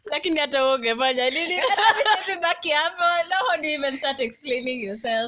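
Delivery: fast words through real laughter; laughing while talking. Real, not acted. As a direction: rattle it out, cracking up. Laughing at the gross story.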